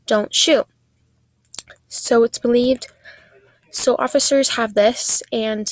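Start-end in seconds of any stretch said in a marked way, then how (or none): 0.1-0.7 a lightly voiced man pleading, louder; mock the husband's cry of don't shoot through the parrot; husband's plea via parrot. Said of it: "and his voice says".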